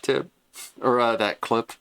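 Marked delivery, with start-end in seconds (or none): none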